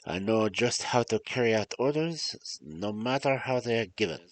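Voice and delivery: storytelling in a put-on French-German accent; muddled French-German accent. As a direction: read the tale with a hammy continental accent, French sliding into German. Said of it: "French accent when this is supposed to be German".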